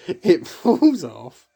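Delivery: slow, loud and high through laughter; laughing. Real, not acted. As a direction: barely get it out for laughing. Recalling the mask falling off.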